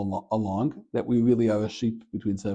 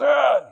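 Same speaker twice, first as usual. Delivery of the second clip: short hammed-up yell, much higher and louder; mock effort yell; 8 dB louder than usual. Retelling the joke's whipper winding up, mock yell. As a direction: bark it out like a whipper swinging.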